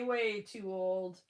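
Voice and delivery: ordinary talk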